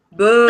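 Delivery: long teasing jeer; playful heckling boo. Pretend. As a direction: boo a friend's bad idea. Heckling friend's food for thought.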